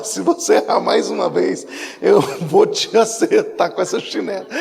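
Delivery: hammed-up threatening mother; mãe brava. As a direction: imitate a mother's threat, drawn out at the end. (imitando mãe brava ameaçando)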